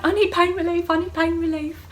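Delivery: high, loud retold wail; desperate demand. Arriving in labour asking for pain relief, high desperate demand. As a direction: act out begging for relief.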